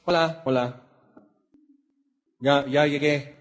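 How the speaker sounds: droning flat hello, no interest; mock indifferent arrival; flat bored greeting. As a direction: mumble it like you're done for the day. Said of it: "O así muy".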